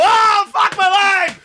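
high, stretched-out wail of defeat; mock death yell. Player imagines hearing himself shot in-game, mock yell. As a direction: pretend you just got shot and yell.

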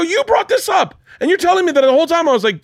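high, rushed retold protest; upset. Husband blaming wife for the argument, upset. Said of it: "And then I got upset".

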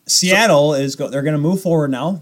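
normal talking voice

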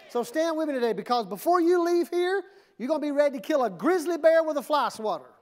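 high, loud, fast pump-up; roaring rallying speech. Real, not acted. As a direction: fire up the crowd. Pumping up the congregation.

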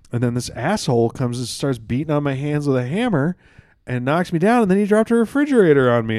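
loud and high, climbing to near shouting; aggrieved, building outrage. Acted. Window washer telling Saint Peter how he died, increasingly outraged. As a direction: build from indignant to incredulous yelling.

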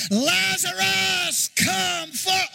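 high ringing shout; commanding call. Pretend. Preacher calling Lazarus with congregation, ringing shout. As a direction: call the dead man out of the tomb. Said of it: "somebody say it with me".

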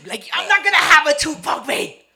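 very loud, high-pitched protest; shouting down an insult; indignant outburst. Retold or imitated. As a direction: blow up in mock outrage. Acted quarrel, rising indignation.

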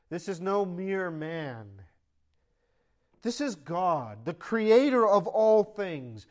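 slow, building to loud proclamation; rising preaching. Acted. Pastor declaring to his church, slow and rising.

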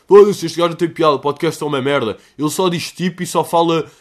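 mock hater rant. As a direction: bark the hater's insults in mock rage. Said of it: "as críticas destrutivas". (a imitar um hater furioso, aos berros)

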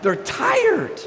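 mock high exclaim. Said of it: "I'm like".

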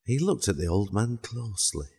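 plain reading voice

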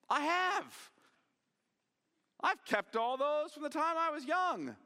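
put-on boastful young ruler. Rich young ruler insisting he kept the law, high-pitched and boastful. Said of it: "somewhat insanely, the rich young ruler says".

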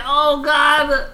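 high-pitched mock-anguished groan; hammed-up despair, voice way up; retold exasperated wail; mock dismay. Own reaction to the retold argument.

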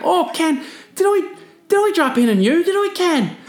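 very high, innocent, bewildered voice; confused surfer. Retold or imitated. Surfer who just burned Ken, feigning innocence in a high voice. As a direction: play dumb in a squeaky high voice. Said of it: "he was, like, really confused".

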